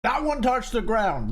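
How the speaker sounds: high, slow dramatic movie line; drawn-out quote with stress on one. Shown as a demonstration. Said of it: "Name that movie".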